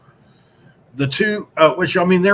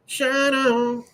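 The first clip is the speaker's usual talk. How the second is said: a tagline tossed up high and light; high echo; 11 semitones higher than usual. Acted. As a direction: repeat the slogan in a silly high voice. Tagline echoed in put-on voice.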